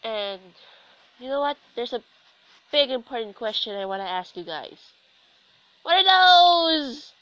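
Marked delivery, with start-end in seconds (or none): 5.8-7.1 very high, drawn-out mock scream; throat-straining yell. Commentator puts a big question to the viewers, mock screaming. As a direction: holler it long and high, straining the voice. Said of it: "My voice hurts already".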